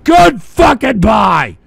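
loud, angry yelling; furious shout. Real, not acted. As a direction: blow up and end the show. Shouting to end the show.

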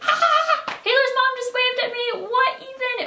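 high-pitched shrieking fangirl squeal; giddy high squeal, voice jumping way up; excited squeal. Retold or imitated. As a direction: squeal it like you can't believe it. Excited squeal retelling mom waving.

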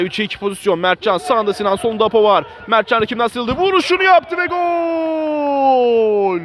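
rising to a goal scream; screaming football commentator. Real, not acted. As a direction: build up and explode on gol. Goal chance, commentator builds to goal.

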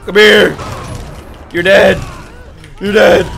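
loud, forceful shouts; aggressive game threats. Pretend. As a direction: yell each threat as a short burst. Attacking enemies in game.

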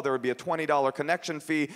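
ordinary talk

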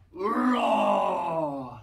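big loud mock roar; playful loud lion roar. Shown as a demonstration. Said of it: "I want to hear some really big roars".

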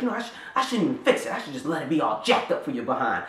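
lightly voiced scolding dad, fast at first; a hint of fed-up father. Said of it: "he was like".

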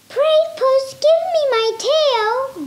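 high, pleading little mouse voice; begging mouse. Acted. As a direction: squeak out a small plea. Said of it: "begged the mouse".

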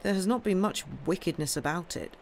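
ordinary delivery

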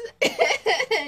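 high bright laugh with the audience; real laugh. Real, not acted. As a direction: laugh along with them.

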